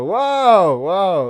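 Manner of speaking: drawn-out, high mock wow; mock amazement. Reacting to a commenter who commented again, mock amazed. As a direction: stretch it out in pretend wonder.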